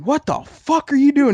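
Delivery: high, loud mock outburst; retold shocked yell. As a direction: act out catching someone in the act. Acts out catching the little brother.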